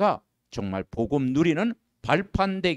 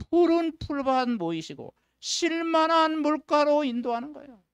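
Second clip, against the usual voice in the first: lifted scripture quote; 12 semitones higher than usual. Acted. (높고 느리게 읊는 구절)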